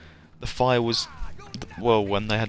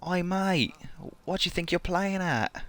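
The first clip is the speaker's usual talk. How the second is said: higher, held-back Australian accent; quiet Aussie; 8 semitones higher, 4 dB quieter than usual. Shown as a demonstration. Streamer keeping it down late at night, quiet Australian accent. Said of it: "doing a quiet sort of Aussie one".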